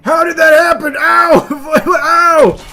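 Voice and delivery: loud high yelps of pain; pained shouting. Real, not acted. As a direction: yell out in shock. Character gets hurt, yelling.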